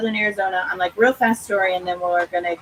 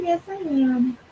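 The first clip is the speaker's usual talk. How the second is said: dog voice; 6 semitones higher than usual. Pretend. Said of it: "She's like".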